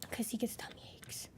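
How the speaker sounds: hushed whispered aside; whispering. Real, not acted. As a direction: drop to a whisper.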